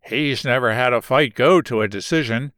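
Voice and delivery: plain reading voice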